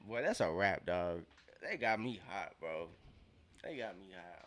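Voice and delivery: normal talking voice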